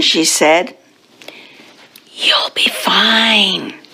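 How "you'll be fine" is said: slow, breathy whisper of reassurance; soothing whisper. Acted. Mum leaving Splat at school, soothing whisper. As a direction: whisper it slowly, like a mum comforting her child.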